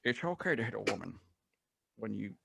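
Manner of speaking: hushed, lightly slurred Scottish impression; soft impression of a Scottish film star, s's turned to sh; Sean Connery. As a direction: quietly attempt a Scottish star's slushy delivery. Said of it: "Sean Connery".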